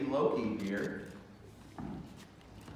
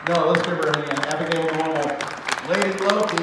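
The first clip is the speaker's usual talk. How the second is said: loud, high announcer call; announcer shout; 4 semitones higher, 8 dB louder than usual. Real, not acted. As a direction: call her name out to the crowd. Announcing contestant to crowd.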